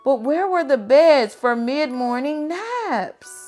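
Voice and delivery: plain reading voice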